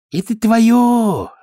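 high, slow broken Russian; eager Chinese suitor. A narrator's reading. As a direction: promise her everything. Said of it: "сказал он".

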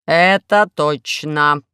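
very slow, loud mechanical agreement; gloomy robot. A narrator's reading. Robot backs up the inspector, gloomy robot voice. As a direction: drag it out in a heavy machine monotone. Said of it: "мрачно подтвердил робот".

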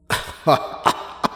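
echoing zombie chuckle; creepy laugh. A narrator's reading. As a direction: laugh like a sinister undead monster. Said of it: "bật cười lên một tiếng ghê rợn". (tiếng cười ghê rợn)

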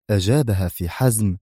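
ordinary delivery

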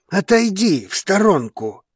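commanding caution; warning. A narrator's reading. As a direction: warn her to get clear. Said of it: "предупредил Драгос".

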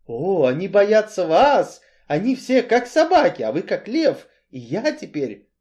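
high, loud, excited flattery; admiring, timid Cain. A narrator's reading. Timid man praises his protector, eagerly. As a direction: gush up high to your protector.

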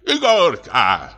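high, drawn-out call into the dark. A narrator's reading. Old comic calling for the watchman, high drawn-out call. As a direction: call the name across the empty hall. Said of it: "крикнул он".

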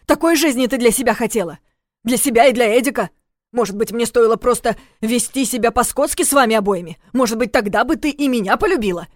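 loud, high heated rant; angry. Acted. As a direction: fire it back in anger. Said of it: "Кирилл тоже разозлился".

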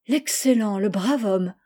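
mild admiring exclamation; a touch of delighted admiration; exclaiming. A narrator's reading. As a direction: exclaim warmly in praise, lightly acted. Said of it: "s'écria Gertrude".

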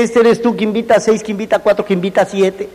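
quick, offhand run-through; rattled-off pitch. Retold or imitated. Telling the brother what to say, quick rattled-off pitch. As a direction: rattle it off like it's nothing. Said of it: "tú dile".

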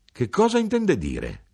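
high-pitched indignant-style cry; grido. A narrator's reading. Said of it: "E lei gridò". (domanda gridata in tono acuto)

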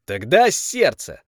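loud, slow certain call; confident shout. Acted. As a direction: stake everything on it. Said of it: "с уверенностью использовал последнюю попытку".

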